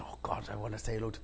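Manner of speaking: hushed, quick nervous mumble; imitated timid fan, very soft; nervous murmur. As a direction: murmur it shyly, as if to yourself. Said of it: "he was all nervous".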